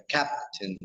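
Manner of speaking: "captain" with the first syllable CAP hit hard and held long; stress first syllable. Shown as a demonstration. Teacher modelling "captain" for learners, stressing CAP. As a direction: lift and stretch CAP, let "tain" fall away short. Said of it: "the first syllable is, uh, stressed".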